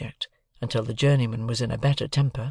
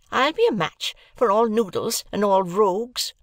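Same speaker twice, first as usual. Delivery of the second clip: high-pitched, combative scolding; rising rage; 9 semitones higher, 4 dB louder than usual. A narrator's reading. Sister takes on noodles and rogues, rising rage. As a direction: work yourself up into a temper. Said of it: "work herself into a mighty rage".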